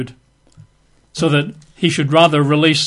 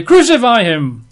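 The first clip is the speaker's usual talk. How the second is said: Crucify him shouted higher and louder; crowd shout; 7 semitones higher, 5 dB louder than usual. Retold or imitated. Crowd yelling at Pilate for crucifixion, mock shout. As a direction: mob cry Crucify him, hammed-up. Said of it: "they cried out the more exceedingly".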